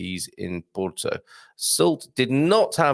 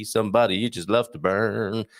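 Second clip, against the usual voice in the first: put-on Cornish accent; 4 semitones lower than usual. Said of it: "I did that in a Cornish accent".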